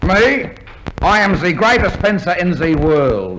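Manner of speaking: boastful fake French accent; mock French accent. Fake fencer bragging to an inspector, mock French accent. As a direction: brag like a phoney French swordsman. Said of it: "with a French accent".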